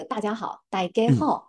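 大家好 said in Teochew; Teochew dialect. Shown as a demonstration. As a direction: model 大家好 the Teochew way, sounds pushed forward. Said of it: "就是我们来说这个".

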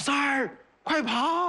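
high-pitched carrying warning; calling out. Acted. Warning friend San at the pit, calling out high. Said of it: "全村都听见了".

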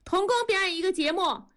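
high, quick crowd call; crowd heckling. A narrator's reading. Crowd urging a worker to perform, high jeering call. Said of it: "人们立刻起哄".

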